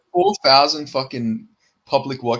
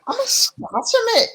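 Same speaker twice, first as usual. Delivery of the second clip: put-on very high, failed Scouse accent; squeaky attempt at Liverpool Scouse; badly faked Scouse; horrible Scouse; 4 dB louder than usual. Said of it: "that was horrible".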